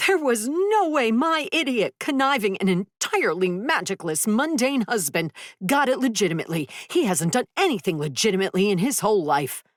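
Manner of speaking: high heated rant; angry rant. Acted. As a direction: spit out the insults. Luna ranting about husband.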